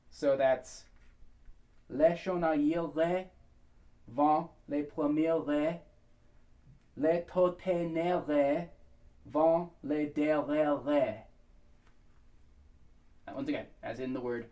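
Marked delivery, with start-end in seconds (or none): 1.9-11.2 very slow lyric, "premières" and "derrière" ending on pure "eh"; flat eh endings. Shown as a demonstration. Coach applying the vowel to the lyric, slow and deliberate. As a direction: separate the phrases, no y glide on "derrière". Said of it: "It wants to be eh".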